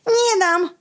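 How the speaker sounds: put-on stubborn kid refusing; boy shouting. Boy refusing to give the spade, mock shout. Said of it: "krzyknął chłopiec".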